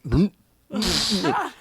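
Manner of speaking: mock gurgle, the last "bloop" shooting up high; a comic choking gurgle as he lies on the floor; imitated gurgling. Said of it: "I'm laying there going".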